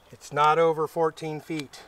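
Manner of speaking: ordinary talk, nothing marked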